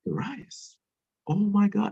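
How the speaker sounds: high-pitched gasp of amazement; amazed woman. Retold or imitated. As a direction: voice an astonished elder woman seeing his face. Mother Jaguar amazed at his face.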